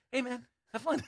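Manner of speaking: high-pitched imitation of a friend's caution; mock friendly caution. Quoting friend's aside to him.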